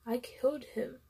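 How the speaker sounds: whispered, numb confession; stunned whisper. A narrator's reading. Narrator after hearing the boy died, whispering. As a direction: breathe it out in shock.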